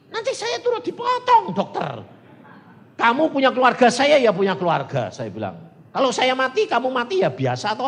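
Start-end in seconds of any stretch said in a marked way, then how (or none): 0.1-2.2 high-pitched, frantic warning; alarmed boatman. Retold or imitated. Boatman warns the doctor they will die ashore, high alarmed cry. 3.0-5.1 loud, raised-pitch argument; forceful retort. Retold or imitated. Doctor argues down his scared boatman, loud forceful retort. 5.9-7.9 loud, raised-pitch argument; forceful retort. Retold or imitated. Doctor argues down his scared boatman, loud forceful retort.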